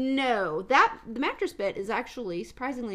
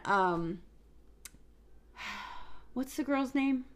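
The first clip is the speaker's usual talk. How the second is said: ordinary talk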